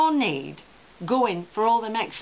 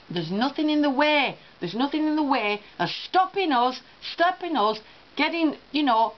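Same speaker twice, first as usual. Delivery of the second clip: ordinary delivery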